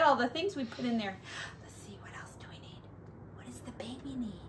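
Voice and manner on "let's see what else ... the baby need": whisper. Real, not acted. Whispering at bedtime.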